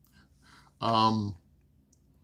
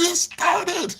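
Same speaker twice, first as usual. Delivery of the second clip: high-pitched excited squeal; imitated excitement; 3 dB louder than usual. Imitating son as the car started, excited squeal. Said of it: "get so excited when the car started".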